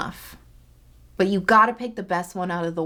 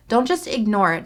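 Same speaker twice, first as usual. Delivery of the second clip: ordinary talk, nothing marked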